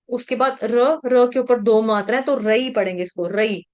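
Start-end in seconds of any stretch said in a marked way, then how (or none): none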